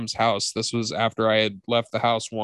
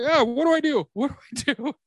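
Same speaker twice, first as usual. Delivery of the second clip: high, helpless squeal; mock panic in a squeaky voice; panicked little brother; 14 semitones higher than usual. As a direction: play the trapped kid begging for help. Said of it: "he was just like".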